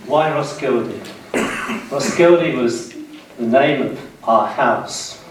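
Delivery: ordinary delivery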